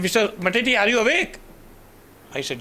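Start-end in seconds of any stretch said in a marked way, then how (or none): 0.0-1.4 high, loud nurse calling to him. Retold or imitated. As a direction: wake the patient, loud and high. Head nurse checking if awake.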